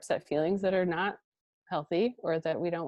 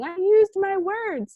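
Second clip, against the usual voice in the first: put-on much higher, slow little-kid voice; imitating a toddler; 12 semitones higher, 5 dB louder, slower than usual. Mother imitating her toddler son on video, high childlike voice. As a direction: mimic a proud two-year-old saying it. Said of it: "a two-year-old just toddling around and saying".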